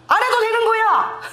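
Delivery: high laughing exclamation. Real, not acted. Preacher laughing to congregation at her own story. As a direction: burst out laughing mid-sentence.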